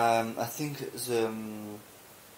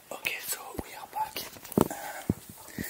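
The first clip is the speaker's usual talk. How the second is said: hushed whisper, not to wake someone; 4 dB quieter than usual. Real, not acted. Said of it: "I have to whisper because my daughter is sleeping".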